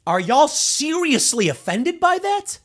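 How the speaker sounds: slow, high, loud disbelief; incredulous. Real, not acted. Asking those offended by a simple morning greeting, incredulous. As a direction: stretch it out in high-pitched incredulity.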